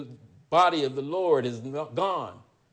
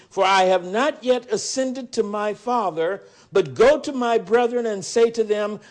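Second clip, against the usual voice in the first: raised, ringing Bible reading; scripture reading; 5 semitones higher, 4 dB louder than usual. Acted. Preacher reading the resurrection story aloud, raised and ringing. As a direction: proclaim the gospel passage to the congregation.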